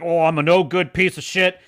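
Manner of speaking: mocking a brooding hero's self-loathing; mock Butcher. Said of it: "he's like".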